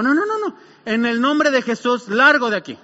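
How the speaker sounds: preacher's emphatic banishing command; acted forceful rebuke. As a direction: order the devil out with authority. Acts himself rebuking the devil.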